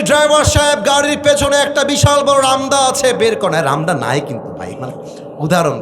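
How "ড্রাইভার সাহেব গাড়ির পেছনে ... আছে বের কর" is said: furious command. Retold or imitated. Ordering driver to fetch machete.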